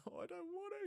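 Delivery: put-on childish reluctance; hammed-up scared whimper; whiny fear. Scared to pull hand off.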